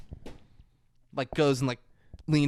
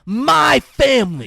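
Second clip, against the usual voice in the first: loud, slow, higher shouted claim; hammed-up furious yell; mock angry shout; 8 semitones higher, 11 dB louder, slower than usual. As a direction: bellow it like a skinhead. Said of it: "He goes".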